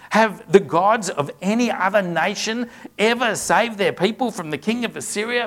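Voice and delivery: scripture read as an enemy's loud, bold taunt. Acted. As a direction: call it up to the wall with swagger. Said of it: "louder and with more boldness".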